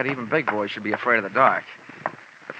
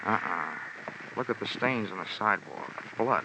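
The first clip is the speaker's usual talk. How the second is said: hushed; 3 semitones lower, 11 dB quieter than usual. Acted. Detective finds blood, hushed.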